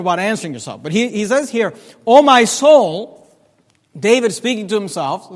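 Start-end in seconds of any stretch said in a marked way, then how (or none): 2.1-3.3 intoned scripture. Acted. Intoned scripture, slow loud.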